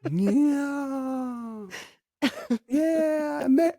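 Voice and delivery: very high, squeaky mocking tone. Mocking Mary about half the lit tree in a high voice.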